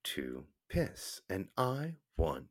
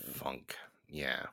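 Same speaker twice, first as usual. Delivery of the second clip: quiet; 5 semitones lower, 10 dB quieter than usual. Real, not acted. Quiet agreement.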